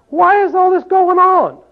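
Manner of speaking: high, slow plea of why; high pleading why. Acted. Preacher voicing complaint.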